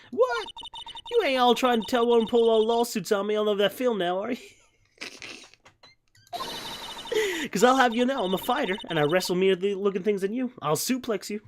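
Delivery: high, excited southern woman; southern accent. Acted. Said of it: "southern drawl".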